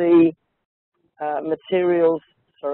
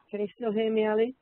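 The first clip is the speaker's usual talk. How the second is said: thin sound through a computer mic; speaking into a laptop mic; laptop microphone; 4 semitones higher, 9 dB quieter, faster than usual. Real, not acted. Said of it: "a change in my audio as I'm just about to".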